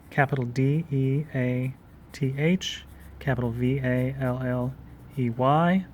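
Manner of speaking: Death Valley spelled letter by letter; spelling it out. Shown as a demonstration. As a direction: dictate each capital letter. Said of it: "spelling out for notes".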